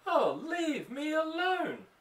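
very high, slow shout; nervous woman cat. Acted. Mother voicing the cat as a nervous woman, very high, slow shout. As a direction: cry out like a frazzled nervous woman. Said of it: "That's when she's a nervous woman".

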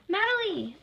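high, loud calling of a name; excited high-pitched exclamation; joyful cry. Acted. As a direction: cry out to a friend. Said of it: "they cried and hugged".

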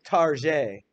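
"Target" said tar-ZHAY, mock French; joking French style. Shown as a demonstration. Teacher jokes to class about the store, mock-French "Target". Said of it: "some people do when they're referring to the store".

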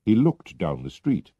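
ordinary delivery, nothing marked

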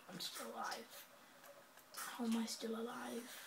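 stunned breathy whisper. Real, not acted. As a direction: whisper it in disbelief. Surprised to survive.

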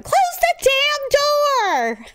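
shrill, slow, very high yell; mom yelling. Retold or imitated. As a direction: holler across the house.

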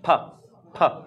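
"ফা" with a strong puff of air; aspirated demo. Shown as a demonstration. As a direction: push air onto the hand on "ফা". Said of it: "আপনার হাতে বাতাস লাগবে". ("ফা" জোরে বাতাস ছেড়ে)

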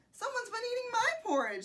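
high-pitched storybook mother bear; Mama Bear voice. A narrator's reading. Mama Bear finds her porridge eaten, high voice. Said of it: "said the Mama Bear".

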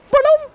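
a high, loud jingle sound; a high vocal jingle to announce the next message; sung ba-dum sting. Pretend.

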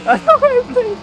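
put-on high, loud childish whine; whiny child. Losing gamer to friend, hammed-up whiny child. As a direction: throw a pretend tantrum. Mock tantrum after losing control.

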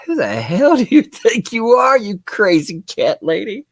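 lightly voiced annoyed judge; mock angry judge. Streamer voicing the judge scolding the lawyer, mildly mock angry. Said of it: "the judge is like".

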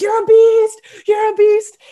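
high, loud mock team cheer; imitated hype yell. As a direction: yell it like teammates psyching up a swimmer. Teammates yelling her on at blocks.

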